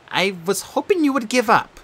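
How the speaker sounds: put-on calm her old friend's guilt; gentle girl ghost. Bianca's voice.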